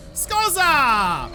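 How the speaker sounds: loud surprised cry; startled yell. Real, not acted. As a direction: yell at the sudden enemy. Enemy appears.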